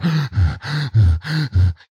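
repeated audible panting gasps; demonstrated rapid breathing. Shown as a demonstration. Trainer showing a seller breathing out of step with a calm client, panting. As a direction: pant heavily as a breathing demo. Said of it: "então respiração. Se você tá".

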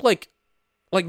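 ordinary delivery

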